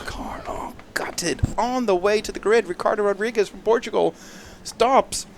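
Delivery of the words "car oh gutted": whispered. Real, not acted. Car stops before the race.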